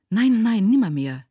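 loud cry of refusal, dropping in pitch; vehement refusal. A narrator's reading. Mother forbidding her son the uncle, crying out vehemently. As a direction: burst out in protest.

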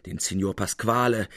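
usual speaking voice

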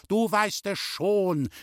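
high, slow, angry retort; screamed accusation. A narrator's reading. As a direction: shout it accusingly as the aunt. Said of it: "schrie die Tante". (hoch und vorwurfsvoll geschrien)

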